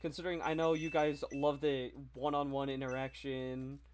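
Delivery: ordinary delivery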